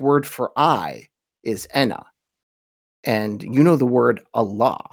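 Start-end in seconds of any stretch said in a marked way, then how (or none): none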